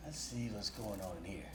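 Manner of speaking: low, hushed deadpan exterminator; low, quiet and matter-of-fact; exterminator in the scene. Retold or imitated. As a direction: quietly size up the infestation. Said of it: "This is me in the room".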